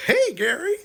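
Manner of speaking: high, loud bubbly greeting; hammed-up cheerful girl greeting; wicked chipper. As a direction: chirp it like the happiest girl alive. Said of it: "She's like".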